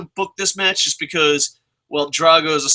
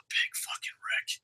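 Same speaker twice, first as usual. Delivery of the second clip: very soft whispered curse; disgusted whisper; 16 dB quieter than usual. Real, not acted. Fan whispering in disgust at the wrestler's name. Muttered curse after sigh.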